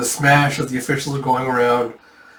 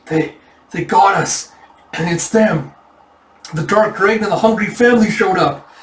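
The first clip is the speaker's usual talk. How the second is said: urgent retold alarm, pitch climbing; mock-panicked battle report; alarmed soldier; 5 semitones higher, 3 dB louder than usual. Injured soldier reporting who attacked them, alarmed.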